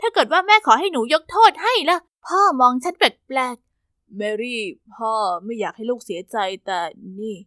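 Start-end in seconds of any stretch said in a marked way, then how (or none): 4.2-7.4 concerned father. Acted. Father warns daughter about mother.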